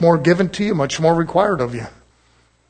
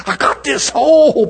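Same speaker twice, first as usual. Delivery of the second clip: mock pleading believer; 7 semitones higher, slower than usual. Repeats quote in put-on voice.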